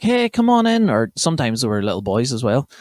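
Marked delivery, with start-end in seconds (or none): 0.0-0.9 high-pitched mock girl voice; imitation of an inviting wee girl. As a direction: pitch way up like a young girl calling someone in. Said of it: "these wee girls are like".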